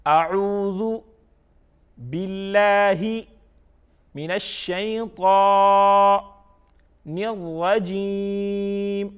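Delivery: slow chant with a pause after اعوذ and after باللہ; each part separate, الشیطان and الرجیم held long and level; recited by parts. Shown as a demonstration. Teacher leading learners part by part, slow separated recitation. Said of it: "یہ ہم نے by parts پڑھ لیا".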